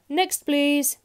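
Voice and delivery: loud, high call; dismissive shopkeeper's shout; shouting grocer. A narrator's reading. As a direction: shout for the next customer. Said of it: "shouted the grocer".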